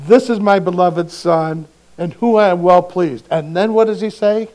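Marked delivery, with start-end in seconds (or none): 0.1-3.3 louder, proclaiming from the cloud; proclaiming the beloved Son; booming voice of God. Pretend. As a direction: announce it like God from heaven. God's voice from the cloud.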